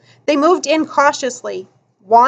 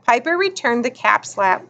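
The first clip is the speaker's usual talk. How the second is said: ordinary delivery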